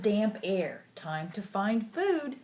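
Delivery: ordinary delivery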